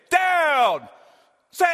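high, loud snap-count shout; quarterback call. Retold or imitated. Quarterback calling the play to his team, shouted. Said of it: "the quarterback saying".

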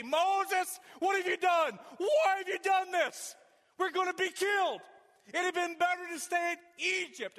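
high, loud panicked wail at Moses; loud, high desperate crowd cry; crying out in complaint. Acted. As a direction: shout it up high, like a frightened crowd.

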